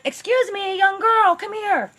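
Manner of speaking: high, sugary mock-innocent voice; fake sweet lure. Carla luring the girl to the car, high fake-sweet voice. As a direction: play the lost friendly lady. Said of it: "She's like".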